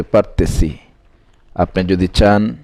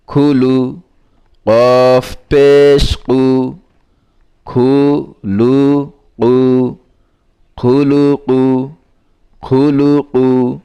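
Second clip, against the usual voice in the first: measured, chanting recital of spelled syllables; recitation drill; 6 semitones higher, 3 dB louder, much slower than usual. Shown as a demonstration. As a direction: read each syllable out slowly like a teacher drilling a class. (ধীরে ধীরে সুর করে বানান পড়া)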